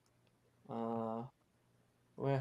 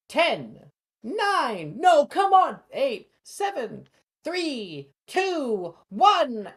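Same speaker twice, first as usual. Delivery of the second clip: high, loud count; referee countdown; 13 semitones higher, 10 dB louder, much slower than usual. Acted. Flitwick counts out the duel.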